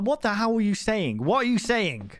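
annoyed at himself. Retold or imitated. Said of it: "I'm like".

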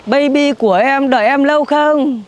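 loud, high sugary call to her lover; loud gushing greeting. Acted. Mother greeting her lover after abandoning child, loud and gushing. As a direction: gush loudly over the new man.

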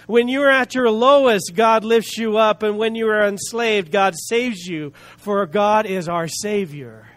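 emphatic, rhythmic preaching, each answer weighted. Real, not acted. Preacher declaring God's grace to the congregation, emphatic preaching. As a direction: proclaim each promise forcefully, slowing on the payoff.

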